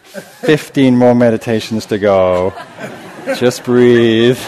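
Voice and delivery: mock-tender there-there, chuckling; a parent calming a child, but laughing at it; laughing mock soothing. Voicing gentle self-soothing, laughing.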